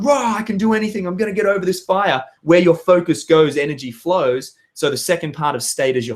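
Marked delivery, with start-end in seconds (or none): 0.0-2.2 high-pitched, pumped-up self-talk; fired-up battle cry. Acted. Coach acting out focus before the firewalk, fired up. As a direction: hype yourself up with a roar. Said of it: "Or you're focusing on".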